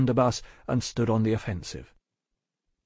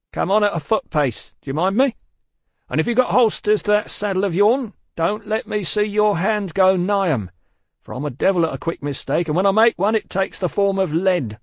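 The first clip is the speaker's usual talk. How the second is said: raised, higher guard's warning; threatening orders; 9 semitones higher, 5 dB louder than usual. Acted. Guard ordering Jerry closer, raised threat. Guard warns rider at gunpoint.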